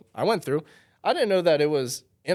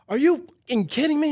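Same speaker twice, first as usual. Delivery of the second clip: much higher, louder, enraged yelling; furious rant; 13 semitones higher, 5 dB louder than usual. Retold or imitated. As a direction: impersonate a furious man going off. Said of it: "goes off".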